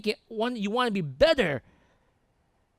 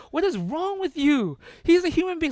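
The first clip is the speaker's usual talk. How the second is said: high, raised-voice scolding imitation; exasperated wife; 8 semitones higher, 3 dB louder than usual. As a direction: tell him off in a higher voice. Exasperated wife scolds starstruck husband.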